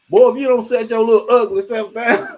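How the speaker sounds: loud mock scolding mother; mama's scolding. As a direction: bark it like an angry mama, laughing. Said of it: "I'm gonna tell you what my mama said".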